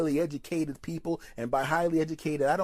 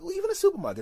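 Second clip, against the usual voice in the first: high, loud squeak on the correction; squeaky correction; 4 dB louder, much faster than usual. Real, not acted. Comic correcting himself mid-story, in a high squeak. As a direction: jump way up in pitch.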